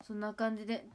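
ordinary talk

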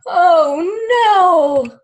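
loud mock-alarmed oh no. Teacher reads the tree tipping over, loud mock alarm. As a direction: cry it out in storybook alarm.

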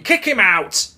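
ordinary talk

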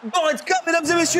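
high, loud goal announcer; commentator voice. Pretend. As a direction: call the goal like TV.

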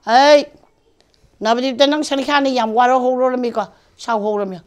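loud, high scolding yell fading at the end; angry shout. Retold or imitated. Songkha scolding Nabadipta, angry loud yell. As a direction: bark out a furious telling-off.

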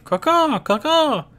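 loud, very high caws; crow cawing. Acted. Narrator voicing crows at evening, loud high cawing.

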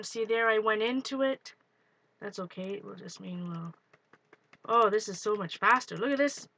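ordinary delivery

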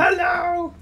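high mock shout. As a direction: yell it into a phone. Mock phone answer scream.

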